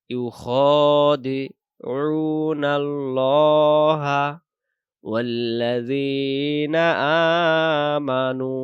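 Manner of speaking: loud, very slow chanted recitation; slow Quran recitation. Shown as a demonstration. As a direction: recite it drawn out like tajweed practice. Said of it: "এখান থেকে".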